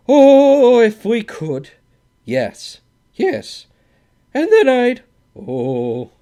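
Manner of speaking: loud, very high yearning cry; yearning fanatic cry. A narrator's reading. Both men after the Swede leaves, loud fanatic cry. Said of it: "a yearning, fanatic cry".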